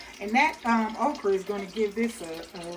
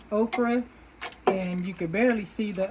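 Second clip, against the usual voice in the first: ordinary talk, nothing marked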